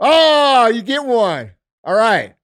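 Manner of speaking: big high, loud cheer of a verdict; mock-triumphant announcement. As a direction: announce the prize with a loud, playful whoop. Mock-dramatic verdict after thumbs up.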